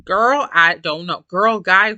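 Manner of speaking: ordinary delivery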